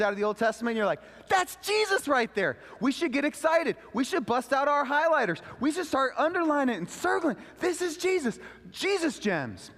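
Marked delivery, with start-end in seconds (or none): 1.3-2.6 high, loud thrilled exclaim; excited discovery. Retold or imitated. Student thrilled at finding Jesus in the Old Testament, excited. 2.8-9.7 excited. Real, not acted. Urging the church to get excited.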